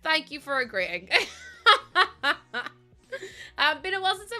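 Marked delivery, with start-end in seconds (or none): 1.1-2.7 a high, loud laugh that falls away; real laugh. Real, not acted. Streamer laughing after a viewer promises to behave, loud high laugh. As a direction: crack up at chat's promise.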